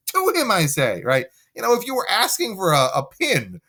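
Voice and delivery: high, pushy coaxing; urging Lucio. Acted. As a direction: egg her on to beg harder. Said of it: "Lucio's like".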